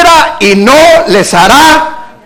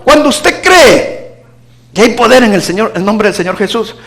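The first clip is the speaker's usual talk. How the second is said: ordinary delivery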